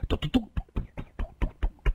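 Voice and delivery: quick high glugging noise, imitating wine pouring down; gulping sound. A narrator's reading. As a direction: glug it fast like someone downing a jar. 孙驼子仰脖灌酒. (模仿大口灌酒的咕嘟声)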